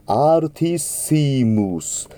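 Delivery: アルティッシムス with a clean ティ, slow; ティ not ツィ. Shown as a demonstration. Teacher correcting ti before S. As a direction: keep ティ in アルティッシムス. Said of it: "ツィではなくてティになります".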